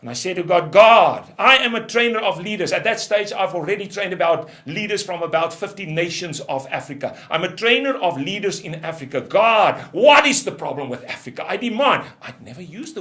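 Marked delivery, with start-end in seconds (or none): 0.7-2.7 loud anguished cry to God; a man shouting up at God in frustration. Retold or imitated. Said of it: "I shouted and cried". 7.3-12.0 loud, frustrated demand; shouting at God. Retold or imitated. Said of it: "I shouted and cried".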